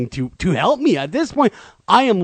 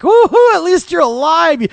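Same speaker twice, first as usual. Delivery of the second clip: much higher, loud cheer of relief; relieved whoop; 12 semitones higher, 7 dB louder than usual. Retold or imitated. Narrator relieved the parrot still moves, loud high whoop. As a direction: burst out with joy that it lives. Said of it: "I'm like".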